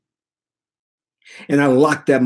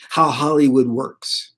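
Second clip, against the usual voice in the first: ordinary delivery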